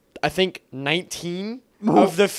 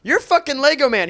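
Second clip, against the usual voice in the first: excited recognition; 7 semitones higher, 4 dB louder than usual. Retold or imitated. Stranger recognizing him, retold.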